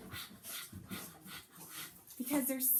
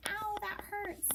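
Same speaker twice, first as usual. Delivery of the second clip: mock pained ow; 9 semitones higher, slower than usual. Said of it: "Like saying".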